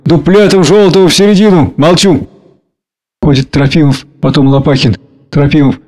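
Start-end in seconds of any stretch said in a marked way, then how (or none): none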